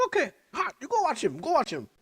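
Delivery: very high, loud mock boss shooing you off. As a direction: squeal it like the boss waving you away. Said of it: "And then he's like".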